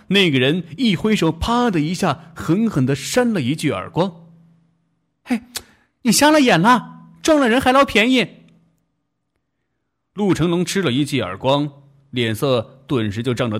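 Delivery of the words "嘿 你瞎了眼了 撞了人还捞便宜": loud, high, fast angry rebuke; angry scolding. Acted. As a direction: snap at him furiously. Girl slapping him, scolding.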